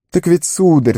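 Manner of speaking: high, nervous start; trembling gardener. A narrator's reading. Sam starts answering the wizard, high and trembling. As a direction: begin your excuse in a high, frightened voice. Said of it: "всё ещё дрожащим голосом".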